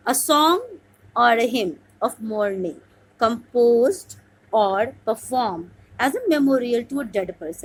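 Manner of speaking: slow dictation reading. Shown as a demonstration. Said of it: "teacher reads out exam question".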